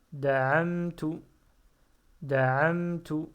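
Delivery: loud, careful model reading of Ta'ala for pupils; Ta'ala said loud and clear, each syllable full. Shown as a demonstration. Teacher reading aloud to learners, loud and careful. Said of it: "teacher models Arabic reading".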